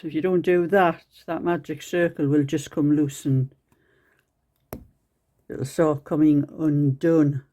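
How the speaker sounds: talking around a needle held in the mouth. Real, not acted. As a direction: speak with a needle between the lips. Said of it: "I've got a needle in my mouth".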